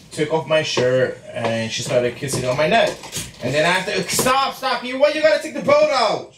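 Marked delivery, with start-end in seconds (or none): 4.1-6.3 pained yelp. Real, not acted. Girlfriend hitting him mid-story.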